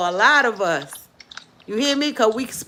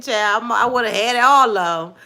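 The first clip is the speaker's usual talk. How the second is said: ordinary talk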